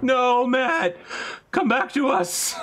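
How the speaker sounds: high, slow mock cry of despair; hammed-up falsetto plea, slow and loud; mock anguished wail. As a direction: wail it high like a betrayed fan begging him back. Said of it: "And I'm like".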